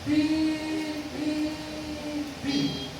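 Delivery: sung censor bleeps; bleeped swearing. Shown as a demonstration. Preacher bleeping Peter's curses, sung beeps. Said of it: "¿qué es?".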